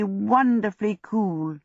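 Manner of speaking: ordinary delivery, nothing marked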